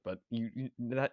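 stuck on repeated words; can't get the words out; stuttering. Real, not acted. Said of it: "holy cow, I cannot speak".

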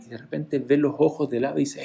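usual speaking voice

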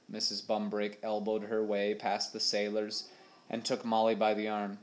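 ordinary delivery

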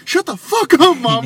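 high, loud shout at mom; retold yell at mom. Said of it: "he hears me say".

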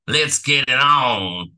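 slow, drawn-out mock Southern American accent. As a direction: stretch it out in a hammed-up Southern voice. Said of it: "That was almost not horrible".

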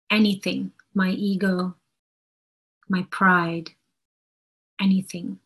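ordinary talk, nothing marked

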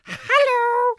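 very high squeaky cherub; cute cherub voice. Pretend. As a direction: squeak it like a chubby baby angel. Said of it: "the cute, chubby kind with wings".

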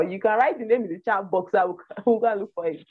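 ordinary talk

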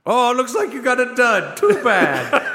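high, loud mock sympathy; mocking salesman. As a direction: gloat like a sly seller. Genie seller scene.

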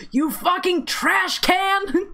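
high, slow outraged yell; mock gamer rage. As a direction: yell it at a bad teammate. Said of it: "so I'm like".

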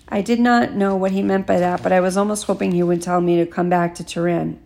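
ordinary delivery, nothing marked

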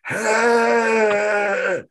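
high, sustained tuneless yell; screechy mock rock-star note; bad karaoke wail. Shown as a demonstration. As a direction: wail like an awful karaoke singer. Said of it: "what everyone else is hearing is".

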